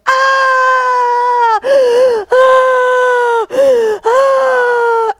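high, loud mock wail of a hurt kid; child's crying wail. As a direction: wail like a child who just fell off a bike. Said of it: "they go".

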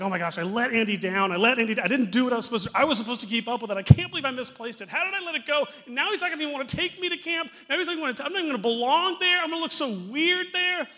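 very high, fast, loud panicked babble; freaking out Woody. Retold or imitated. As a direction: rattle it off in a frantic panic, voice climbing. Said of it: "He's freaking out to all the other toys".

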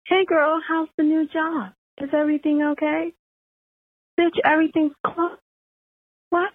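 high-pitched retold back-and-forth; mock texting voices. Caller replays her in-law's texts, high mock voices. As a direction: act out both sides in a high, mocking voice. Retelling a text exchange with relative.

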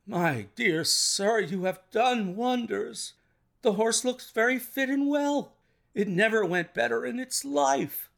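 high, effusive praise; delighted amazement. A narrator's reading. Colonel praising the detective over his recovered horse, delighted. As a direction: gush with astonished gratitude.

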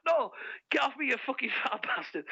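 high mock telling-off through laughter; hammed-up angry girlfriend, laughing; mock angry shove-off. Girlfriend shoving him off, mock anger. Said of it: "she's like".

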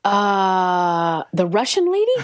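stall, then squeak up in mock disbelief; stunned disbelief. Retold stunned reply to casting news.

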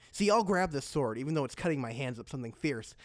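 ordinary delivery

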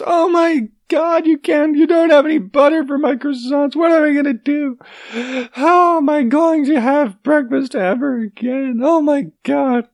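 very high, hammed-up French wail; mock French lament. Panicked French customer with no butter for croissants, in a high mock lament. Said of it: "that's what France sounds like".

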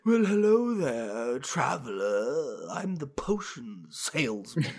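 slow, drawn-out hammy salesman voice; swooping, theatrical shopkeeper character; silly potion salesman. Acted. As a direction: stretch each word like a mysterious potion seller. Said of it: "even if it's silly".

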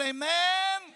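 high, loud shout of amen. Shown as a demonstration. Pastor leads the congregation, shouted amen. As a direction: lead the crowd in a big amen. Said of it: "And everybody shout".

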